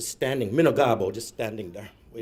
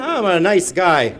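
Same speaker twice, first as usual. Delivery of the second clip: ordinary talk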